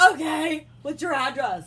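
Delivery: high, loud, gushing yes; hammed-up eager squeal; mock over-eager. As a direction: parody someone far too keen to come over. Mocking an over-eager reply.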